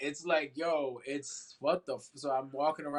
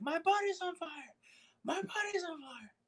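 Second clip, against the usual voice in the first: very high, squealed mock panic; mock screaming in pain. Four-year-old waking from a burning dream, high mock wail. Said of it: "screaming in pain".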